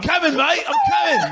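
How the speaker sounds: very high squeaky voice; squeaky mock reply. Dog answering the kangaroo in a squeak.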